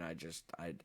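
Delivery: ordinary talk, nothing marked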